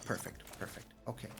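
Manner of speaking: whisper. Real, not acted. Whispers after drawing a land.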